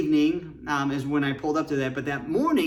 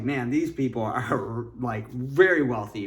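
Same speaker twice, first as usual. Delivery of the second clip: mock awe; 4 semitones lower than usual. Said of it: "I was like".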